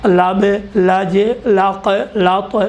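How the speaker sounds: each syllable drawn out slowly, one by one; slow sounded-out syllables. Shown as a demonstration. Teacher to students, slow syllable drill. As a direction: spell out لب، لج، لق، لط slowly as a demo. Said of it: "اس کے لیے مثال آپ کی خدمت میں دے دیا".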